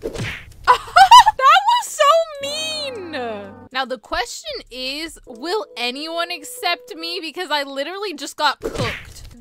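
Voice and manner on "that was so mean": high, loud mock-offended cry; mock outrage. Player to whoever just insulted their griffin, loudly mock-offended. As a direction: wail it in playful indignation. Reacts to being insulted in game.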